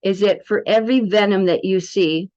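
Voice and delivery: ordinary talk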